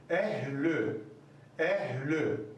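"Ehlü" with the lü kept thin, low and calm; thin lü demo. Shown as a demonstration. Teacher modelling "Ehlü" for students with a thin lü. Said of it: "lü'yü de ince okuyun".

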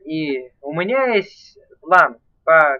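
ordinary talk, nothing marked

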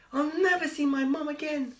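high loud little wail. A narrator's reading. Spinderella wails, lost outside the school, high and loud. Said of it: "she wailed".

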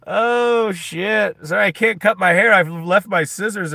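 sudden loud, high "oh shit"; startled outburst. Real, not acted. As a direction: blurt it out, caught off guard.

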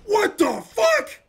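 slow, high, loud disbelieving yell; real outraged shout; stunned shout. Real, not acted. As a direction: bellow it out in shock. Shocked at hit batter.

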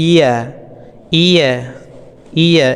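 ইয়া with mid tongue on the palate; Arabic letter ya. Shown as a demonstration. Teacher demonstrating ইয়া to students. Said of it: "এভাবে".

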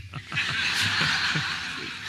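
high breathy unvoiced laughter; real laugh. Real, not acted. Delighted by his own joke, high breathy laughter. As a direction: let the laugh run on. Joking about the lira.

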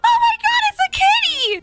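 high-pitched delighted outburst; excited squeal. Acted. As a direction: squeal at finding a cat. Spots a cat easter egg.

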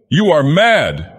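high, loud, drawn-out outcry; sharp cry. A narrator's reading. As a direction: cry out sharply in disbelief, pitch soaring. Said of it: "cried the fisherman sharply".

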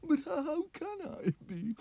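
high, wailing king sobbing oh dear; crying king. Acted. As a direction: wail like a weepy king. King despairs.

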